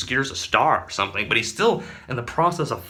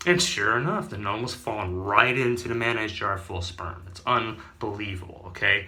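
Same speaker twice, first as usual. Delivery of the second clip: ordinary talk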